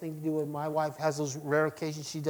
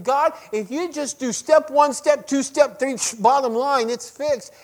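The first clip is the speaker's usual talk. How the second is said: bossy demands; 8 semitones higher, 6 dB louder than usual. Retold or imitated. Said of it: "I can be real bad about saying".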